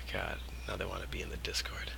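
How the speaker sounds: breathy whisper under his breath; hushed grumble to himself; whispered aside. Real, not acted.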